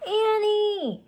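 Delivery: high, loud shout of a name; boy shouting for his sister. A narrator's reading. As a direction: yell her name into the dark. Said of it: "Jack shouted".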